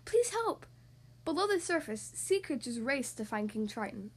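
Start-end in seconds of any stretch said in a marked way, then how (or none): 0.1-0.7 high pleading call across water. A narrator's reading. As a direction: call out urgently. Ariel calls sea creatures.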